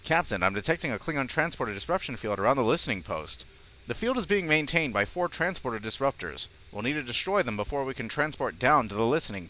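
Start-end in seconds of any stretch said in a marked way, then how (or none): none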